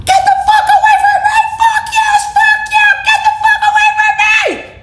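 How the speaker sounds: shrieking way up high at full volume, fast and panicked; screaming bloody murder. Shown as a demonstration. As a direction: scream as if a stranger is grabbing you. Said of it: "I can demonstrate it for you".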